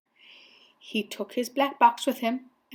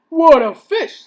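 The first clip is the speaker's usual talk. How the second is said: loud, impressed officer; amazed policeman; 5 semitones higher, 10 dB louder than usual. A narrator's reading. Policeman seeing the huge fish, loud amazement.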